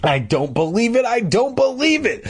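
high-pitched cry of disbelief, climbing sharply. A narrator's reading. Mister McCall finding his melons wrecked, crying out in disbelief. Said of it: "Mister McCall's cries cut through".

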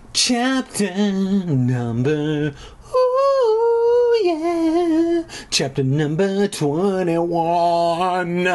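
slow, stretched chapter call; drawn-out announcer. Acted. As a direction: announce it grandly, slowly. Mock announcer chapter title.

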